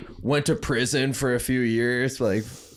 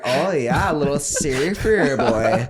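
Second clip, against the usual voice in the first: higher, drawn-out Minnesota accent, hammed up; exaggerated Minnesota accent; 3 semitones higher, 5 dB louder, slower than usual. Hometown girls teasing the surfer boy, exaggerated Minnesota accent. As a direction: ham up the Minnesota vowels. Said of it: "all the girls are, like, kinda, like, nasally".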